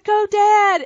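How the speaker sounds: high, loud cheer from the stands; retold cheering shout. Cheering father-in-law on at the tractor pull, loud high shout. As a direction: yell it across the tractor pull. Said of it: "I'm screaming for him".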